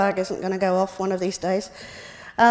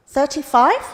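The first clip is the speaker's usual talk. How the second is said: higher, louder, playfully unsure guess; husband's guess; 5 semitones higher, 6 dB louder than usual. Retold or imitated. Husband teasing wife about her age, with a playful questioning guess. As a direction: ask it like a sweet naive guess.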